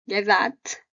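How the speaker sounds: usual speaking voice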